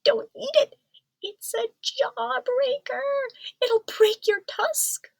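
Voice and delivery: very high, urgent mouse voice; a tiny mouse pleading in alarm; squeaky mouse. A narrator's reading. Mouse warning Ganesha not to eat it, high squeaky alarm. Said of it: "squeaked Mister Mouse".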